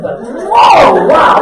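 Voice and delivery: loud, high yelp of shock; shocked shout. Acted. Tea drinker hitting the sugary last sip, shocked shout. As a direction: shout as if jolted by a huge sugar hit.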